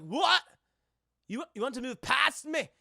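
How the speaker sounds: high, loud mock indignation; affronted Scotsman. Scotsman asked to move aside on the bridge, indignant. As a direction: imitate an outraged Scot challenging someone.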